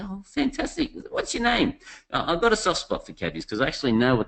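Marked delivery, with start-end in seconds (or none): 0.0-1.8 much higher, bright eager surprise; mock cheery delight. Passenger greeting the taxi driver, high excited delight. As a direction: gush at the cabbie like you're thrilled.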